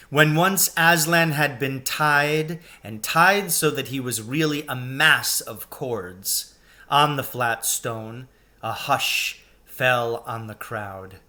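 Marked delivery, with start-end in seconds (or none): none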